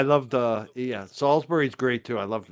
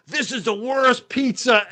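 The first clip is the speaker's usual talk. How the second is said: high, loud, drawn-out complaint; mock an outraged pizza critic, slowly and loudly; hammed-up retold disgust; disgusted outburst; 10 semitones higher, 8 dB louder, slower than usual. Retold friend's disgusted verdict.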